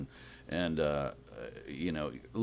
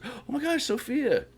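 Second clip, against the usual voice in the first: much higher, gushing hello; excited greeting; slower than usual. Retold or imitated. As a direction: greet an old friend in delight, pitched way up. Tarantino greeting Sophia.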